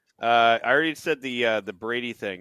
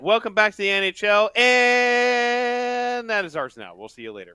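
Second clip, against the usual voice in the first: mock announcement; 7 semitones higher, 6 dB louder than usual. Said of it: "It's like".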